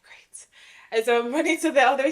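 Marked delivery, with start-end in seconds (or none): 0.0-0.5 a barely audible sarcastic great; deadpan whisper. Retold or imitated. To the agent after yet another setback, sarcastic whisper. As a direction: whisper it, utterly defeated. Sarcastic reply to more bad news.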